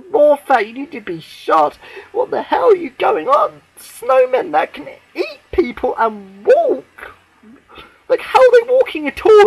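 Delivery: high, loud, indignant; loud angry rant. Real, not acted. Fan yelling at the writer Moffat, loud indignant rant. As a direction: yell it at the writer.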